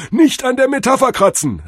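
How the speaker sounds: very high, loud guru warning; jive-talking drug-vision god. A narrator's reading. God advises Cordell against overthinking, high loud guru. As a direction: warn him like a trippy guru. Figure of Tod Hermes.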